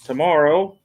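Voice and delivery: ordinary delivery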